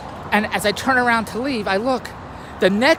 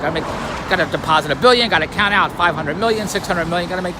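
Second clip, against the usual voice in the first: usual speaking voice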